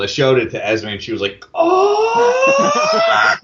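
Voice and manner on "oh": very high, loud gasp; excited squeal. Retold or imitated. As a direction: squeal with delight. Esme's squeal.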